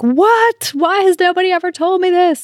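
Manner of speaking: voicing the listener's aha moment; mock stunned realization. Host voices listeners' discovery moment.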